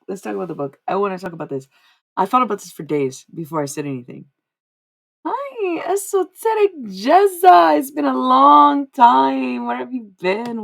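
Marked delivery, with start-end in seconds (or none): 5.2-10.6 put-on loud, high, drawn-out greeting in a vague Australian accent. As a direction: greet a long-lost friend in a channelled Aussie-like accent. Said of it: "Where is this accent coming from?".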